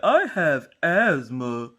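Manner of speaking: "I have asthma" as a put-on whiny excuse; mock excuse. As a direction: stretch "I have asthma" into a lazy whine. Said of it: "people that are like".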